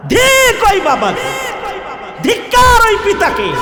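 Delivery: high, outraged sermon cry; fiery preaching. Real, not acted. Preacher shaming fathers who never taught the kalima, fiery preaching. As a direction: cry shame over the congregation. Denounces fathers at full force.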